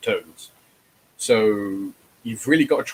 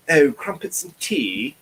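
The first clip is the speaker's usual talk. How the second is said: mock posh British accent, higher, slow and louder; 6 semitones higher, 4 dB louder, slower than usual. Mocking Americans' cliché British, slow high posh British accent. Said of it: "thinking of the usual".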